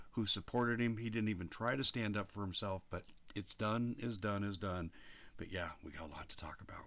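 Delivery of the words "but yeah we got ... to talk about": whispered aside. Real, not acted. Quick aside after a rant.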